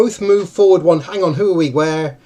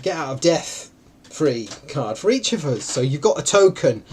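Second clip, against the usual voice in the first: normal talking voice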